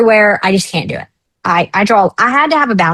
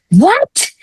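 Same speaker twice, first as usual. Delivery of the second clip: shocked what; 11 semitones higher than usual. Retold or imitated. Friend shocked by the news.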